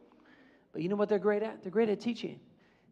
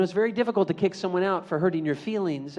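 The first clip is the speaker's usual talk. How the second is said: normal talking voice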